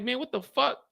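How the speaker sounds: high, disbelieving what the fuck; incredulous. Retold or imitated. To the lawyer on the phone, a high incredulous reply.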